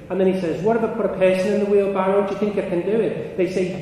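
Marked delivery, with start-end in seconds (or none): 0.6-3.4 much higher, loud, excited dare; imitated showman at full pitch; retold shout. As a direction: top the last challenge, calling to the whole crowd. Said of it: "he's shouting at everybody".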